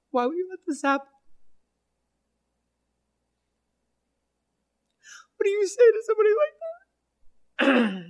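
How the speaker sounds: high, breaking voice through real tears; weeping while she retells it; crying. Real, not acted. Pastor retelling a widow's loss to listeners, openly crying. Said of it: "oh my gosh, I'm gonna cry".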